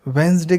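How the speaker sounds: "वेडनेसडे" said "वेंसडे"; silent D. Shown as a demonstration. Teacher repeating it for learners, "वेडनेसडे" without the D. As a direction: leave the first D out of "वेडनेसडे". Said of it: "डी है इसकी बिल्कुल भी pronunciation नहीं आएगी".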